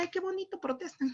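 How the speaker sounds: high, soft, charmed exclamation; naive charmed delight. Retold or imitated. Visitor in Chile admiring a protest she took for a festival, high and soft. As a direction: coo it like a charmed tourist. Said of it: "Yo dije".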